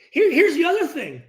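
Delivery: ordinary talk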